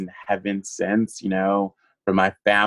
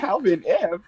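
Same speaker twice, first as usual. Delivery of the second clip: laughing disbelief; 10 semitones higher than usual. Real, not acted. Laughing disbelief retelling offer.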